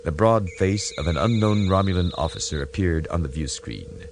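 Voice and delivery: ordinary delivery, nothing marked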